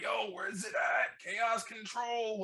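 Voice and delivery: high, drawn-out mock clamoring; retold excited demand. Fan retells wanting his move in the movie, excited.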